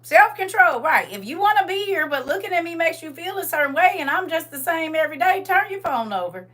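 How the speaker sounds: put-on high, loud sing-song talk to a baby; bright, high voice for a little one; playful baby talk. Addressing grandbaby on phone, playful.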